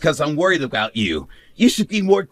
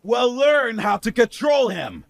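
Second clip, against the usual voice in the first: raised, high-pitched angry demand; angry snap; 7 semitones higher than usual. Acted. Husband blaming his wife for the baby's crying, angry snap. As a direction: snap it as a higher-pitched order.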